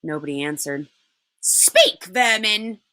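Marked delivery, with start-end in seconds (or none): 1.4-2.8 contemptuous command. Acted. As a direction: order them to talk, with scorn. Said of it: "she said again".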